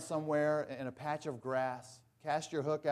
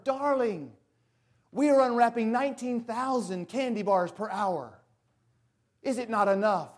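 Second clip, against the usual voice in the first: higher, louder mock-pleading father voice; indulgent father; 7 semitones higher, 8 dB louder than usual. Father pleading with demanding daughter, a hint of indulgent. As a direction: plead gently with a spoiled daughter. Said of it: "he says to her".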